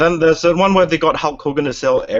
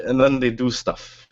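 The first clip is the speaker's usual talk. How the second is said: ordinary delivery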